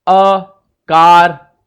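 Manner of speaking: कार stretched very slow and loud; stressed demo. Shown as a demonstration. Teacher demonstrating the vowel in car, loud and slow. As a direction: draw out कार loudly to show the sound. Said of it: "देखिए कैसे".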